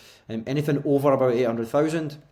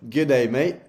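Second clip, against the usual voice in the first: put-on light Australian accent, a bit louder; 4 dB louder than usual. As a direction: say it like a casual Aussie greeting, lightly. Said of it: "in an Australian accent".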